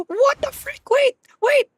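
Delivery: very high, loud startled cries; shocked shriek. Real, not acted. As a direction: yelp in disbelief at the screen. Sudden in-game shock.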